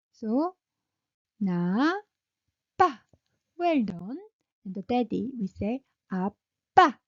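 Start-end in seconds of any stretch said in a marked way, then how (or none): 6.1-7.0 아빠 with a loud, raised 빠; high-pitched 아빠. Shown as a demonstration. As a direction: call 아빠 like calling daddy. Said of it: "So high pitch".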